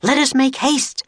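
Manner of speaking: high, alarmed urging; pressing, high-pitched alarm; urgent cry. Acted. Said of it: "cried Teddy".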